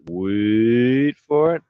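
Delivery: slow build-up. Shown as a demonstration.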